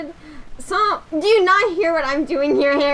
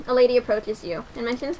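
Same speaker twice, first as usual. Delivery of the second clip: normal talking voice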